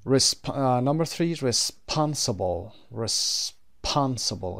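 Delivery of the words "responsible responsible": slow "responsible", stressing SPON; stress on second syllable. Shown as a demonstration. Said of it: "قسمت دوم هست".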